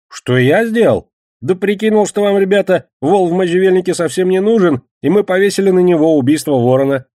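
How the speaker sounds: high, loud self-justifying voice; indignant Кегля. Acted. Кегля is asked what he did, answers indignantly. Кегля защищает свои действия.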